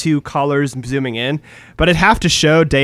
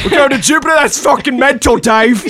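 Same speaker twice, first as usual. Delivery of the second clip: much higher, louder laughing Cockney shout; Cockney Hal; 10 semitones higher, 5 dB louder than usual. Pretend. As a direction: shout it in a jokey Cockney accent. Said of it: "this Cockney Hal".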